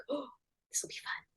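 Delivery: whispered "this will be fun"; delighted whisper to herself; excited whisper. Retold or imitated. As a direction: hush it like a secret thrill. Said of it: "I was like".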